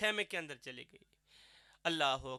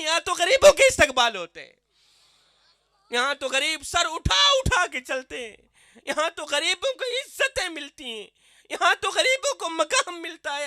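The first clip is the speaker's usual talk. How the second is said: fervent preaching; 7 semitones higher, 11 dB louder than usual. Acted. Preacher telling Umar's reply to the Bedouin at full passionate volume.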